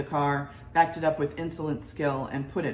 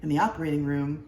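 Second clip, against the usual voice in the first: usual speaking voice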